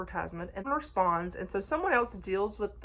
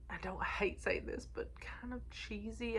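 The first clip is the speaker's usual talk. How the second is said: hushed whisper, dropping almost to nothing; whispered confession; 3 semitones higher, 9 dB quieter than usual. Real, not acted. Reviewer admits to viewers the book felt cheesy, in a whisper. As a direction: whisper it like a guilty secret. Reluctant admission about the book.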